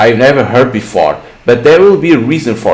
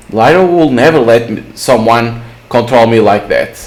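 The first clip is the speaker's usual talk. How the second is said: ordinary delivery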